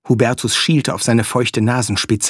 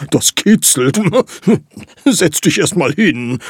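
laughing; 5 semitones higher, 3 dB louder than usual. Acted. Flea tickles the dog.

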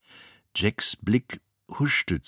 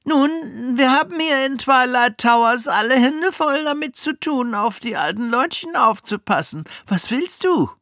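Miss Swine; 7 dB louder than usual. Acted.

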